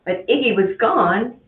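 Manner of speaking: usual speaking voice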